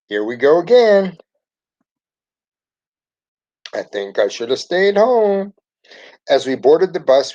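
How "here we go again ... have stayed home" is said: put-on worried kid. A narrator's reading. Nervous kid dreading the trip.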